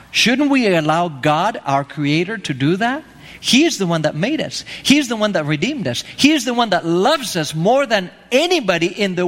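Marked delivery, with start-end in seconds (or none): none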